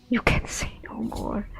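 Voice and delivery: breathy mocking whispered echo; whispering. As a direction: whisper the line back at him. Echoing the beggar's cryptic line.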